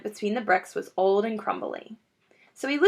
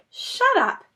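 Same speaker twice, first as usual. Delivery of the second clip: snapping; 13 semitones higher than usual. Acted. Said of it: "said Mister Fox".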